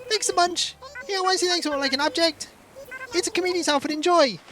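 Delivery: high, bright-eyed cartoon villager; chirpy villager voice. Streamer voicing a game villager, high and chirpy. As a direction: read it like an eager little game character. Reading villager dialogue aloud.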